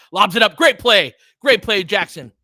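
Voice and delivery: thrilled shout of great play; excited call. Real, not acted. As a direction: call the highlight like you saw it live. Fan calling a highlight lob.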